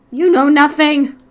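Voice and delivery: loud indignant shout. Pretend. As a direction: yell it back, offended. Defensive outburst as monster.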